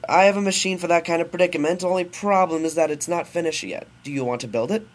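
high, loud eager professor; scientist character. Acted. Scientist offers his son a machine, hammy and loud. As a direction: announce it like a hammy inventor. Scientist figure voiced in story.

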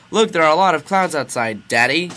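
put-on high, loud little-kid call; excited child. Child points out clouds to daddy, excited.

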